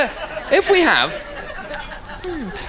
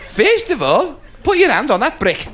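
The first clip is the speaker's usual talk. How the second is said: high, deliberate teacher to a beginner; Columbus; 8 semitones higher than usual. Acted. Columbus instructs the chief about the brick, high emphatic voice. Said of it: "this is Columbus again".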